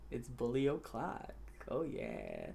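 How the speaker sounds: very soft, low and slow; low, drawn-out creepy taunt; hushed mock menace. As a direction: savor the threat under your breath. Same catchphrase, now hushed menace.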